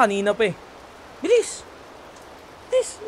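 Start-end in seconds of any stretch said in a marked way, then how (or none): 1.2-3.0 urging. Acted. Urging rival to hurry.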